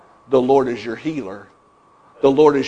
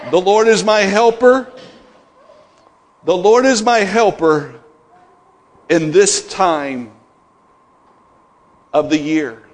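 raised, ringing declaration; the preacher proclaims the Lord is his helper; preaching; 4 semitones higher, 3 dB louder than usual. Acted. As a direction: proclaim it to the congregation.

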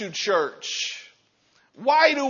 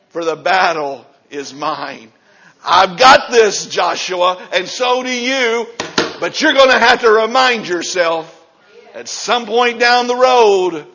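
loud, driving sermon build; full-force preaching; 8 dB louder than usual. Real, not acted. Preacher urging the church to be strong, at full force. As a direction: preach it hard to the congregation.

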